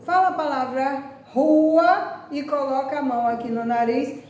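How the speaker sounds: ordinary delivery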